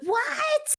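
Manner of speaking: very high, strained "what" from a harried worker; frazzled yell. Acted. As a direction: shriek back as if at breaking point. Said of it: "about to lose his ever-loving marbles".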